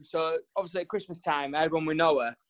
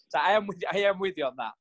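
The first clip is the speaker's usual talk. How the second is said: normal talking voice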